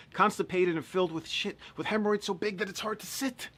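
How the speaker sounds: high voice climbing as if straining; hammed-up high toilet strain; comic strained climax. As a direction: push the pitch up, mock agony.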